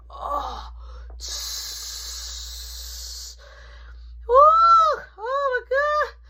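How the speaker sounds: high, slow, loud cry of relief; mock relief cry. In the bathroom after holding it in, hammed-up relieved cry.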